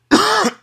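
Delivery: sharp real cough; a sudden hacking cough. Real, not acted. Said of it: "Excuse me".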